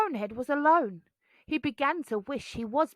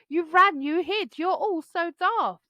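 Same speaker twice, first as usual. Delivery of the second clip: teasing; 7 semitones higher than usual. A narrator's reading. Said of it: "Bonehead laughed".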